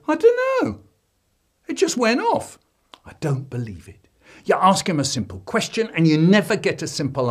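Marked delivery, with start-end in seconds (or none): 0.0-2.6 put-on high whiny kid voice; boy pleading innocence to his dad; child voice. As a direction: squeak it out like a guilty little boy. Child denying the blame.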